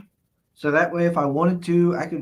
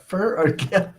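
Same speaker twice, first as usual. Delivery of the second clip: ordinary delivery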